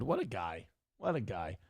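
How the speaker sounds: usual speaking voice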